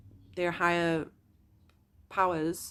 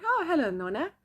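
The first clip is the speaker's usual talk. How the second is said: bright, higher welcome to a loved one; happy hello to gran; delighted greeting; 8 semitones higher, 6 dB louder than usual. Retold or imitated. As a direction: greet her like family arriving. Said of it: "So then I'm".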